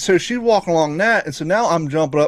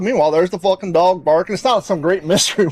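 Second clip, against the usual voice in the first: usual speaking voice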